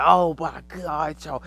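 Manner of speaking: slow, loud, stunned oh my God; drawn-out amazed cry; awed gasp. Retold or imitated. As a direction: gush with wonder at the sight. Awed exclamation at heavenly throne.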